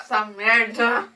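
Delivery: loud, slow exasperated shout. Real, not acted. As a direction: yell it out, exasperated. Reacting to awful chocolate.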